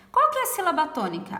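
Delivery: usual speaking voice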